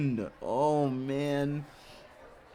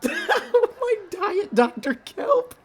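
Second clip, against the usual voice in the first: laughing fit; 4 dB louder, much slower than usual. Real, not acted. Laughing hard at the line.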